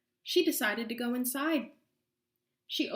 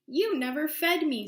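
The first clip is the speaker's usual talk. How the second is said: defiant cat; 6 semitones higher, 6 dB louder than usual. Acted. Cat retorts to witch.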